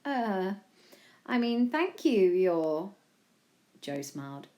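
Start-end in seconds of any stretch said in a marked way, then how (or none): 0.0-2.9 stammered, halting thanks; slow wow, then hesitant thanks; awed stammer. A narrator's reading. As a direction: stumble over your words, searching for the title. Overwhelmed, searching for a title.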